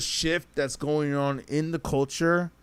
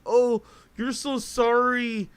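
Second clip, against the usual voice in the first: mocking; 8 semitones higher, slower than usual. Mocking what rappers push.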